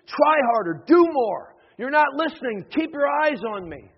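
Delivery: loud, insistent demands from the beach; exasperated, pushy coaching shout; shouted instructions. Acted. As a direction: bark orders at a struggling swimmer. Other religions shouting orders at swimmer.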